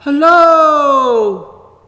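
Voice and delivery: a faraway echo, high and still loud; distant echo. Retold or imitated. Mountain echoing the child's hello from far away. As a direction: call it back as if from across the valley. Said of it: "only far away".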